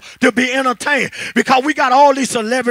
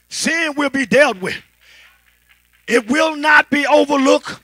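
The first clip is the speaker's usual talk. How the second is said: usual speaking voice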